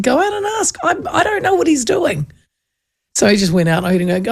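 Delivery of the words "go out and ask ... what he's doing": insistent laughing order. Retold or imitated. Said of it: "I said".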